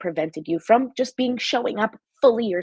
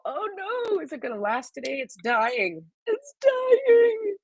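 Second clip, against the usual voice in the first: mock dismay; 12 semitones higher, slower than usual. Candle about to go out.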